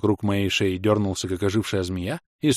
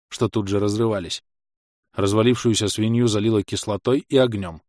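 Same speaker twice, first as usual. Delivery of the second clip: usual speaking voice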